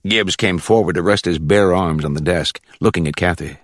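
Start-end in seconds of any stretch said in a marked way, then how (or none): none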